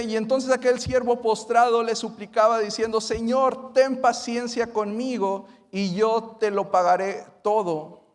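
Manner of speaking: loud, high fervent scripture reading; preaching at full force. Acted. Preacher reading the parable aloud to the church, fervently. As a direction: proclaim the verse to the congregation.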